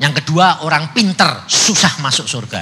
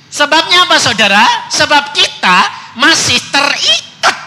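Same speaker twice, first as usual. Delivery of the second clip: high and loud rhetorical question to the congregation; rising preaching; 11 semitones higher, 5 dB louder than usual. Real, not acted. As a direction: throw the question out, higher and louder. (berseru tinggi dan keras)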